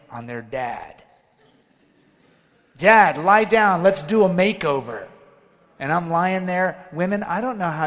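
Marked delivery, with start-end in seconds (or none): 2.8-5.1 mock kid voice ordering dad around; eager daughters. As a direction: imitate his daughters calling him to lie down. Daughters giving dad a makeover.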